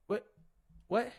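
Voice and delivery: ordinary delivery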